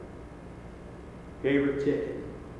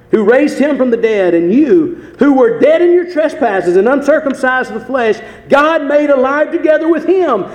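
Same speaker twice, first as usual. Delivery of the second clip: preaching; 2 semitones higher, 8 dB louder than usual. Acted. Preacher reading Colossians to stir the church, loud. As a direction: thunder the scripture at them.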